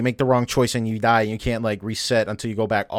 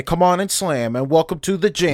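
raised, louder mock beast line; cleric beast; 6 semitones higher, 5 dB louder than usual. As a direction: call it out as a joking beast impression. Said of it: "hear the cleric beast say".

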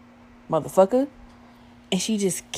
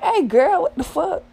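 shocked yelp; 11 semitones higher, 3 dB louder than usual. Retold or imitated. Retold shocked reaction in club.

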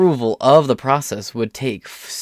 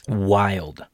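usual speaking voice

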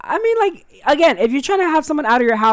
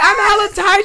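higher and louder mock whine; mock tired whine; 12 semitones higher, 6 dB louder, faster than usual. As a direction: whine it up high. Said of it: "I'd be like".

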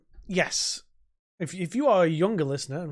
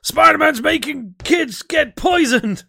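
high, slow, yelling newspaper editor; J. Jonah Jameson screaming; 11 semitones higher, 8 dB louder, slower than usual. Retold or imitated. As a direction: bark it like a furious newspaper boss. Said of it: "I can just hear J. Jonah Jameson screaming".

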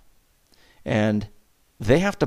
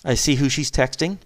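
ordinary talk, nothing marked

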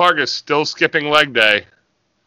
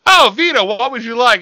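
gear maker; 6 semitones higher than usual. Retold or imitated. Gear maker greeting wrestler Vito, mock fawning and high. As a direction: sweetly ask the wrestler what he wants. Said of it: "and they go".

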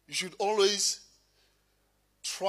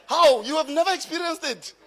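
high, incredulous outburst to the crowd; pitched-up astonished challenge; mock disbelief; 9 semitones higher, 6 dB louder than usual. Real, not acted. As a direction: burst out high in playful disbelief. Mock disbelief at the audience's silence.

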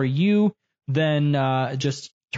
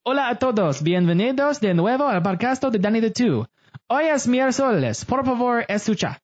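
hammed-up Spanish, pitched higher throughout; mock Spanish; 7 semitones higher than usual. Host reads his podcast intro in mock Spanish, high and showy. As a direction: overplay a Spanish announcer welcoming listeners. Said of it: "That was my Spanish".

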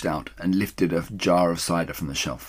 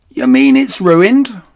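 slow, loud, high dismay; dismayed call; 12 semitones higher, 12 dB louder, slower than usual. Acted. The woman calls up, crestfallen about the tail.

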